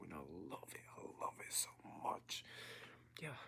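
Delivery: soft, awed whisper. Real, not acted. Confessing his love of the book to viewers, soft whisper. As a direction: let it slip out as a private whisper.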